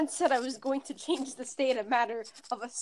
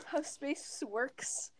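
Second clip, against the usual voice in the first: whisper; 11 dB quieter, much slower than usual. Real, not acted. Laughing aside, drops to a whisper.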